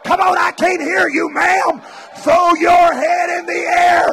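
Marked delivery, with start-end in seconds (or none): none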